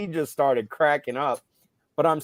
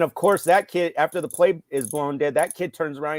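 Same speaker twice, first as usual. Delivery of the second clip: ordinary talk, nothing marked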